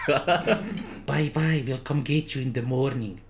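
slow, dull servant voice; Igor's simple lumbering voice; mindless servant voice. Shown as a demonstration. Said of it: "that's not his voice".

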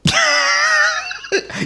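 high burst of laughter. Real, not acted.

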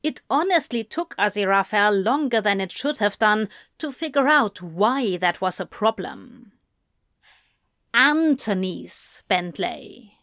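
ordinary delivery, nothing marked

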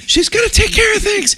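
put-on father shouting reassurance to his kids; yelling over wind. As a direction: call it up high over the storm.

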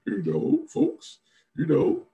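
high, loud sarcastic politician impression; mocking politician voice. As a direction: parody a smug senator's spin. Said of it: "to say, like".

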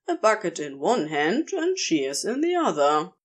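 ordinary delivery, nothing marked

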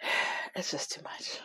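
sighing, breathy whisper of exhaustion; real worn-out whisper; weary whisper. Real, not acted. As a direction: let it out on a tired sigh, whispered. Exhausted tax preparer venting.

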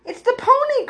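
very high, thrilled exclamation; excited cry. A narrator's reading. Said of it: "cried Edith".

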